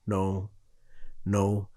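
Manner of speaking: low, very soft muttered repeats; retold muttering. Freaked-out friend in the car refusing to talk, muttering. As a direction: mutter it under your breath, spaced out. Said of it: "this motherfucker started muttering".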